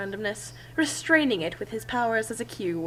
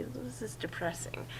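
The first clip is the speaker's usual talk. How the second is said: muttered aside; 14 dB quieter than usual. Real, not acted. Reader's aside mid-sentence.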